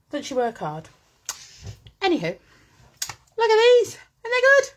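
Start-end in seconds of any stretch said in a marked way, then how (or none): 3.4-4.7 excited. Real, not acted. Excited showing new stamps.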